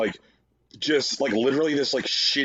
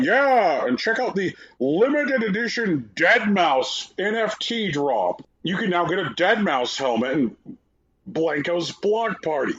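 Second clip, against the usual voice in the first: high-pitched parody product announcement; over-keen reveal with stretched product names; mock announcer hype; 5 semitones higher than usual. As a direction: imitate a gushing showcase presenter. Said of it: "start to, like, announce their various".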